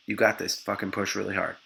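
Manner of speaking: low, soft encouragement; quiet coaching. Retold or imitated. Partner to woman pushing, quiet encouragement. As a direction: coach her under your breath.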